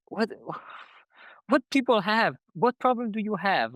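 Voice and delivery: mildly scandalized at people; indignant disbelief. Retold or imitated. Reacting to people's nosy questions, indignant disbelief.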